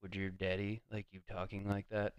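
low scolding aside. Retold or imitated. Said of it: "he was like".

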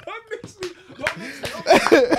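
high-pitched protest through real laughter; laughing protest. Real, not acted. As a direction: beg off while cracking up. Teased, protests amid laughter.

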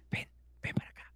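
soft unvoiced whisper; hide-and-seek whisper. Kid hiding, beckoning a friend over, whispered. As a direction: whisper it secretly to a pal. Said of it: "es como que estás en las escondidas".

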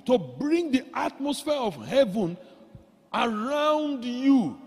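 high, stretched, emphatic preaching; preaching exhortation. Real, not acted. Preacher encouraging Christians to use their advantage in worship, exhorting. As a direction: urge the congregation on, drawing out key words.

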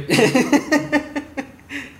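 高声爆笑; 尖声大笑出来; high burst of laughter. Real, not acted. Burst of laughter at cousin joke.

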